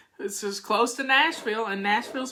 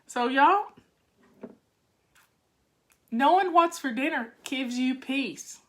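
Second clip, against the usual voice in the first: ordinary talk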